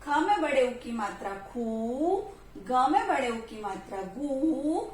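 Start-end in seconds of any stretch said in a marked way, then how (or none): 1.5-2.2 खू with the "oo" drawn out; sounding out खू slowly; long vowel. Shown as a demonstration. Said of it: "कैसे पढ़ेंगे?". 4.2-4.8 गू with the "oo" drawn out; sounding out गू slowly; long vowel. Shown as a demonstration. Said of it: "कैसे पढ़ेंगे?".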